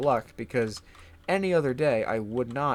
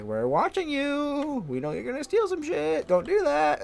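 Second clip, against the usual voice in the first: mock menacing warning; 14 semitones higher than usual. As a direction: pretend threat to the shoplifters. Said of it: "we're like".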